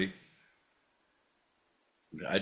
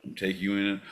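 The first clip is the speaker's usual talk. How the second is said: ordinary talk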